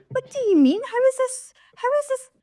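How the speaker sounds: high, disbelieving squeal; incredulous. Retold or imitated. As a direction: pitch it way up in outraged disbelief. Incredulous rant.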